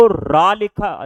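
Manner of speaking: slow demo. Shown as a demonstration. Teacher to pupils, drawing out रा slowly. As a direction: stretch रा so children hear the long aa. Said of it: "बोला जा रहा रा".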